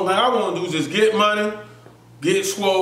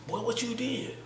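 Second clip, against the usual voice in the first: softer, asked under the breath; quietly pressing him on what he did; hushed question; 5 semitones higher, 13 dB quieter than usual. Retold or imitated. Friend Wood whispers asking what he did, hushed.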